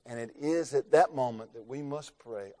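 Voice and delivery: ordinary talk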